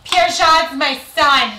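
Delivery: loud, high, drawn-out proud proclamation; hammed-up maternal boast, slow and booming; boasting mother impression. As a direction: proclaim it like a doting Greek mom. Mother boasting of adopted sons, acted.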